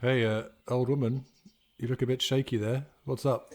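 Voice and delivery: ordinary talk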